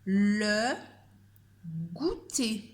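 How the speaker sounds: slow dictation, a pause before goûter. Shown as a demonstration. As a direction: say it clearly so pupils can spell it. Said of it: "Je répète une dernière fois".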